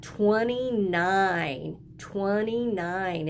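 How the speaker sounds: slowly drawn-out twenty-nine, twenty-nine; spelled out slowly for young children; slow teaching read. Shown as a demonstration. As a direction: stretch it out for the class. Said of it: "Look at that".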